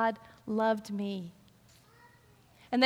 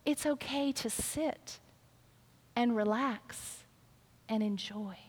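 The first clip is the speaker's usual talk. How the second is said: softer, slow and soothing; calm her down tenderly; Jesus soothing worried Martha, soft and slow; gentle reassurance; 6 dB quieter, slower than usual. Acted. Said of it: "Jesus looks at her and says".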